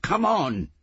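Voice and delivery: high, loud impatient yell; shouted order. A narrator's reading. Woman hurrying a man out of the house, shouting. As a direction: yell to hurry them along.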